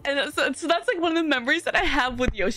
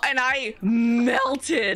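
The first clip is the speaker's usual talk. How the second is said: drawn out very slowly; dramatic confession; much slower than usual. Retold or imitated. Confessing to co-host how praise hit her, slow and dramatic. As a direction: stretch out "melted" for effect.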